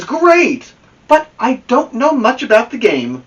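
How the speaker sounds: thrilled to be asked to play; excited. Acted. Amelia Bedelia happily joins the team, high and excited.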